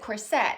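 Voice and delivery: each "corset" said cor-SET; "corset" stressed on SET; stress on second syllable. Shown as a demonstration. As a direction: put the weight on the end of "corset". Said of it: "Putting the emphasis or the accent on the second syllable".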